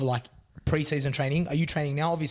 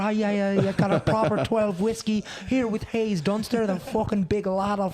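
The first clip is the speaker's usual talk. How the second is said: Conor McGregor impression; 6 semitones higher than usual. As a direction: do Conor McGregor in a thick Irish brogue. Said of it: "That's how Conor McGregor sounds".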